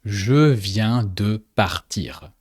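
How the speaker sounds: Je viens de partir said slowly, each word clear; slow French demo. Shown as a demonstration. Teacher slowly modelling Je viens de partir. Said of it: "So in French".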